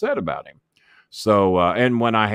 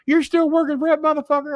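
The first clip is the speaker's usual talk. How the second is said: much higher, louder outraged Jimmy voice; hammed-up indignant Jimmy impression; imitating Jimmy Cornette; 5 dB louder than usual. Jimmy voiced in angry call.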